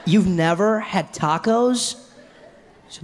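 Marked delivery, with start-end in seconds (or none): 0.0-2.0 very slow, higher incredulous American; mock disbelief. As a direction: drag the question out in hammed-up amazement. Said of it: "they can't believe you haven't had".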